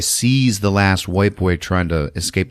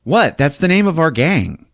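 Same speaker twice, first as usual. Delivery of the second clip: higher, faux-innocent protest; mock innocent thug; 8 semitones higher, 3 dB louder than usual. Gang member defending the gang name, higher mock innocence. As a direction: play dumb like a thug.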